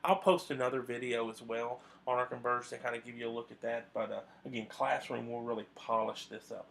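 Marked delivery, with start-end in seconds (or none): none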